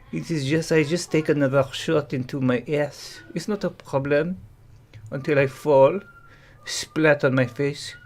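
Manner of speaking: understated mock French accent in English; deadpan Frenchman reading out his posts; English with heavy French vowels.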